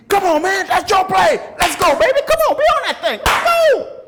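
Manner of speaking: high, loud fired-up shout at a teammate; sideline hype yell. Retold or imitated. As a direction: pump him up, yelling from the bench. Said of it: "you'd be".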